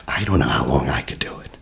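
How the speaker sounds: whisper. Real, not acted. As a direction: breathe it out under your voice. Aside, whispered.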